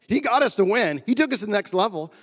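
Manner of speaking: punchy quote, loud and high; imitating emphatic teammates. Acting out teammates' verdict on Jordan.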